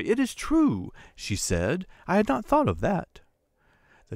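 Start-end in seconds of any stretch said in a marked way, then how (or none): 0.0-0.9 much higher, bursting with sudden delight; a wife suddenly seeing the answer, overjoyed; cry of joy. A narrator's reading. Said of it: "She uttered a cry of joy". 2.1-3.2 much higher, delighted, thought lifted; a wife overjoyed at the idea; joyful realization. A narrator's reading. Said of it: "She uttered a cry of joy".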